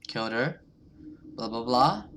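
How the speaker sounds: ordinary delivery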